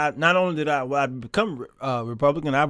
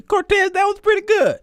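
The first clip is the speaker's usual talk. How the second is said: high laughing outburst, much higher; 5 dB louder than usual. Real, not acted. Laughing at a viewer's joke.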